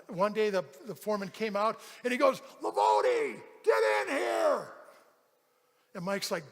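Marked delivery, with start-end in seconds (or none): 2.6-4.7 foreman's yell. Retold or imitated. Foreman summoning a worker across the factory, shouted. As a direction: holler it like a boss across a big room. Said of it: "he'll yell the person's name".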